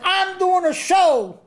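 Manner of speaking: high, slow, hammed-up shouted greeting; imitating a screaming Don King. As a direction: belt it out like a boisterous promoter. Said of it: "he's screaming at everyone there".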